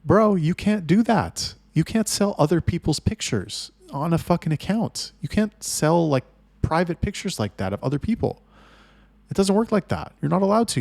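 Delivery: high, forceful exasperated protest; indignant scolding. Retold or imitated. Telling the manager he can't sell those pictures, indignant. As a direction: lay down the law, voice pitched right up.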